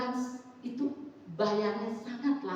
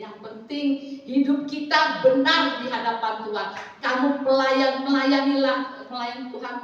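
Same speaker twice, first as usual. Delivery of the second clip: loud, raised preaching voice; emphatic exhortation; 4 semitones higher, 8 dB louder than usual. Retold or imitated. Mother urging her children to serve God, emphatic exhortation. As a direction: drive it home forcefully, louder and higher.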